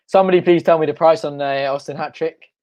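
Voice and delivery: ordinary talk, nothing marked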